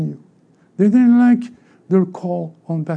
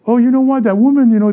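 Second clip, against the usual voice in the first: raised, louder eager voice; eager news-sharing, played up; mock excited report; 5 semitones higher, 6 dB louder, faster than usual. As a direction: act out gushing about a healing. Acting out bragging about a healing.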